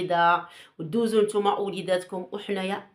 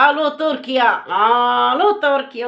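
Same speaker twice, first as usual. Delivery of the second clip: calling out loud and high; hammed-up loud "hello" on a call; mock phone call; 5 semitones higher, 7 dB louder than usual. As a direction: holler hello down a long-distance line. Acts out calling Turkey.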